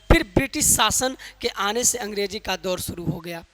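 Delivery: ordinary talk, nothing marked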